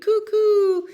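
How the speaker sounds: high, loud drawn-out coo; cooing dove. A narrator's reading. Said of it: "The doves cooed".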